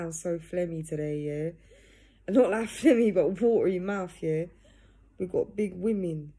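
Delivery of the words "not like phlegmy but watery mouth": laughing. Real, not acted. Laughs at own phlegmy voice.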